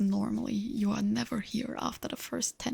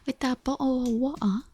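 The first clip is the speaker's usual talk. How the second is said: bottle said as bo'le, louder, in a mock British accent; hammed-up British accent; 3 semitones higher, 5 dB louder than usual. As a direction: overdo the British glottal stop on bottle. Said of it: "English with a stereotypical British accent".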